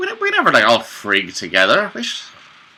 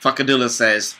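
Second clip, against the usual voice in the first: ordinary talk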